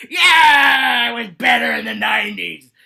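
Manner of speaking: put-on high, loud, shouted old-timer grumble; grumpy old-timer. Old butch complaining about the nineties, loud mock grumble. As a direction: bellow it like a cranky elder reminiscing. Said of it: "some old cooch that's always like".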